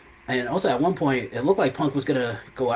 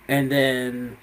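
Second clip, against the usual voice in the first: normal talking voice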